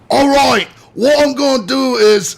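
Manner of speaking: loud, high mock Australian accent. Shown as a demonstration. Quoting a parody croc hunter, loud Australian accent. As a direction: burst out as an over-the-top Aussie parody. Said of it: "and it was like".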